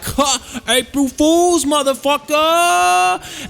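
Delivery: high, slow, gloating taunt; gloating prank reveal. Acted. Brody reveals the prank to the cops, high and gloating. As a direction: drag out the punchline smugly. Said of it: "And Brody was like".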